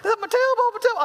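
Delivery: put-on high falsetto whine of an injured boy; hammed-up child's cry; mock screaming. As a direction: imitate a kid wailing in pain. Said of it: "He's screaming".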